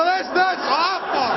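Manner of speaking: high, slow, choked-up dismay; emotional. Retold or imitated. Son to mother after the lie, slow and emotional. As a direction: let it wobble with hurt. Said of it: "I'm emotional".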